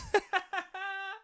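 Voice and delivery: high, soft burst of giggles; real laugh. Real, not acted. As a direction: crack up at your own joke. Laughs at own mocking line.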